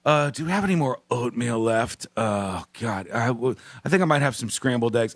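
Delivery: a lightly voiced sleepy Larry; sleepy low voice at breakfast; real-voice morning talk. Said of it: "you wake up and you go".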